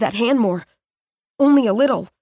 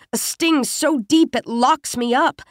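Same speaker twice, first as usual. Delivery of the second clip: ordinary delivery, nothing marked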